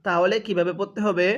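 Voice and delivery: ordinary talk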